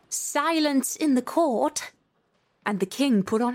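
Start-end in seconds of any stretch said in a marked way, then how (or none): 0.1-1.9 higher, slow court call; crying out. A narrator's reading.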